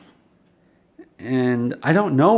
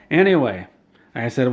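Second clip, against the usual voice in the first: ordinary delivery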